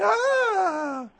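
high, mocking shriek; host mimicking how he was made to sound. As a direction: shriek like a frightened lady. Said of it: "She said that I was going".

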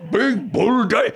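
slow, high mock-demon growl; imitated demon voice. Host imitating the caller's insult, slow demon voice. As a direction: drag it out as a mock possessed demon. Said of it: "sounded just like that demon".

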